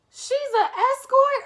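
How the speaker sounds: high, loud incredulous question; shocked disbelief. Retold or imitated. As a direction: screech it in disbelief. Wife shocked by the news.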